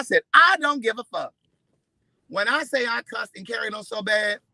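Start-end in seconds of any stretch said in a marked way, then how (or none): 0.3-1.3 loud, defiant shout, higher; angry retort. Retold or imitated. Snapping back at the valet guy, loud and angry. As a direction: yell it right back at him.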